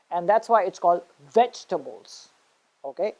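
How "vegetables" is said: vegetables as VEJ-tubbles; stress on first syllable. Shown as a demonstration. Teacher giving the right form, vegetables as VEJ-tubbles. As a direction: hit VEJ in vegetables, reduce the ending. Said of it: "stress on the first syllable".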